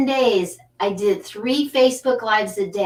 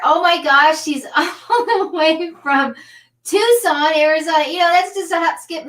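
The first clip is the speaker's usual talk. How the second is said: excited guest introduction; 6 semitones higher, 3 dB louder than usual. Real, not acted. Host bringing on guest from Tucson, excited. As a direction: build up the guest's entrance, pitched high.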